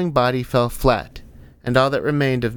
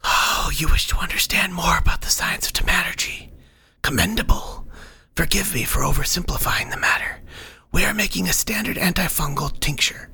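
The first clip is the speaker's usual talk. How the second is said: airy, higher whispered lecture; whispering know-all guide; 6 semitones higher than usual. Acted. Guide explaining antifungal chemistry to a puzzled cleric, condescending whisper.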